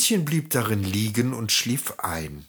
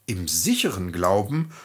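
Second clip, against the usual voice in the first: plain reading voice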